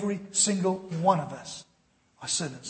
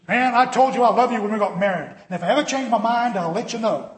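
gruff husband; 4 semitones higher, 6 dB louder than usual. Retold or imitated. Husband answers in joke.

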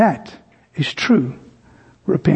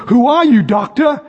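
1 curious crowd; 6 semitones higher than usual. Retold or imitated. Crowd asks the stranger.

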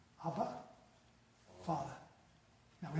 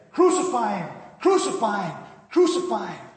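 forceful, loud mob chant; full-voiced repeated shout; crowd yelling; 2 semitones higher, 9 dB louder than usual. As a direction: yell it as a crowd demanding an execution. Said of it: "And the crowd yells out".

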